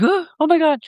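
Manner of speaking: high, startled puppy voice; surprised dog voice. Retold or imitated. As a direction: play the poodle shocked. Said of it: "he's like".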